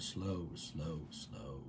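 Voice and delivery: very soft, low mantra; hushed meditative chant; murmured intention. Shown as a demonstration. Repeats her intention as mantra.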